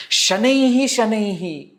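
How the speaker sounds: shanaihi sung out high and drawn; lilting demo. Shown as a demonstration. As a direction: croon shanaihi shanaihi high and slow.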